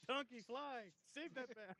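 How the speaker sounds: high, urgent cries; excited call. Acted. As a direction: shout orders to the donkey. Player calls out commands in-game.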